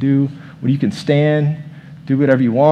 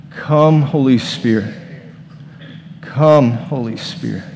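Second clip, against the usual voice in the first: prayer invocation; slower than usual. Real, not acted. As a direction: pray it aloud together, fading. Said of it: "on three, we're gonna do that".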